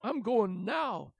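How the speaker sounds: loud, slow insistent voice; determined stamp. Acted. The dragon setting off stubbornly, loud and determined. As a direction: declare it firmly. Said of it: "stamped all eight of his feet".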